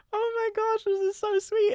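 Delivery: put-on squealing very high, like a delighted young clerk; gushing young clerk. Box office girl gushing at kindness.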